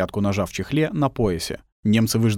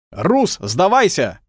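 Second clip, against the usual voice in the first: slow, loud, high drawn-out call; enemy shout; 8 semitones higher, 5 dB louder, much slower than usual. Acted. German soldiers shouting for surrender. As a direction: bellow it slowly across the field. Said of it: "кричали лужёные глотки".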